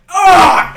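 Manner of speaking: sharp, high, loud cry of pain; pained yelp. Acted. Gudvold crying out as his finger is twisted, loud yelp.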